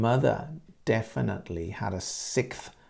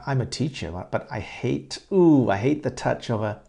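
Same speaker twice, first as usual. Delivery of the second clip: usual speaking voice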